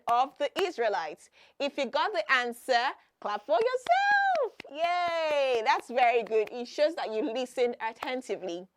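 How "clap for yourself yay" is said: high, drawn-out cheer on yourself and Yay; cheering praise. Real, not acted. Teacher applauding children who got the answer, cheering praise. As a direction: celebrate the right answer, stretching it upward.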